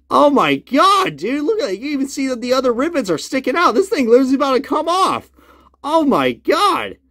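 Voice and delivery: stare at the damage in shock; stunned disbelief. Real, not acted. Shocked at loose rivets.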